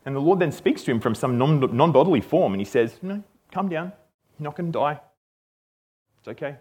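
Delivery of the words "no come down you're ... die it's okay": soft, soothing voice; calm someone down quietly; hushed comfort, trailing softer; gentle reassurance. Retold or imitated. Voicing God calming frightened Gideon.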